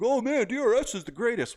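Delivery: high, loud, gushing fanboy mockery; mocking fanboy gush. Mocking the Adam Aaron worshippers, high gushing fanboy voice. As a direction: gush like a starry-eyed follower to ridicule them. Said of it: "all the Adam Aaron worshippers were like".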